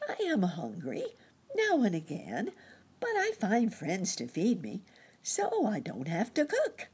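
high-pitched, cheerful little gentleman; happy chirpy cricket. A narrator's reading. Ragged cricket telling Nurse Jane his joys, chirpily happy. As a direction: lilt it high and contented.

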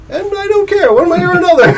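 high laughing voice. Real, not acted. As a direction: laugh through the words. Laughing at being useless.

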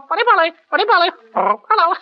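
parrot voice. Shown as a demonstration. Actor shows the host his parrot impression. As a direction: squawk it like a chatty parrot.

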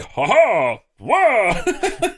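a high, playful leaping "whoa"; mock daredevil whoop. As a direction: exclaim it, swooping up high. Acting the stuntman leaping.

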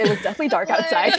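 real laughter through the words; laughing. Real, not acted. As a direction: correct them while cracking up, high and loud. Friends laughing over shared memory.

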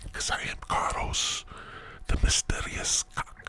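hushed, secretive whisper; mysterious whisper. Pretend. Host introduces himself as a mysterious figure, whispering.

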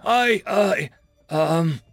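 high, loud panicked stammer. A narrator's reading. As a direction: stumble over words in panic. Panicking at his own corpse.

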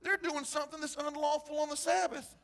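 high-pitched accusing Pharisee quote; legalistic Pharisee. Acted. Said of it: "the legalistic, uh, Pharisee said this".

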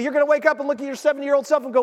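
ordinary talk

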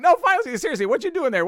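comic needling a front-row guy, loud and pushy; insistent teasing. Retold or imitated. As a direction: keep pressing him, loud and high. Comic pushing audience member.

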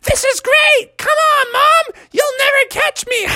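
very high squealed taunt; high-pitched delighted crowing; gleeful baby frog. Acted. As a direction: tease mom in a squeaky voice. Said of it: "Baby was like".

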